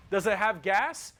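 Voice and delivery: usual speaking voice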